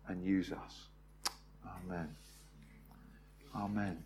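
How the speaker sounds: soft, hushed, reverent prayer. Real, not acted. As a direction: pray it quietly and sincerely. Leads a closing prayer.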